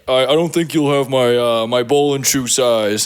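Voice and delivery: lumbering low giant impression; deep voice. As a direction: imitate a huge man's low voice. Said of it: "came in in a deep voice".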